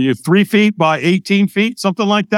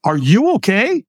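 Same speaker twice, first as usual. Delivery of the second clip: slow, higher puzzled question; 5 semitones higher than usual. Retold or imitated. Stranger checking on the man hiding in the back, puzzled. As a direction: ask it slowly, baffled and concerned. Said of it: "looks at me with a puzzled look".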